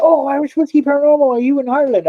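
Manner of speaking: high, sing-song mock of a confused fan. Imitating fans who ask if he lives in Ireland, high and mocking. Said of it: "a lot of people is like".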